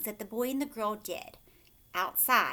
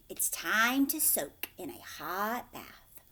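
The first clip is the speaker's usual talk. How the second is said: usual speaking voice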